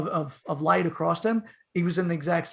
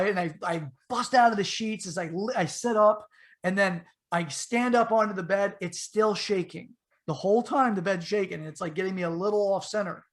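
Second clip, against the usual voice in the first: ordinary delivery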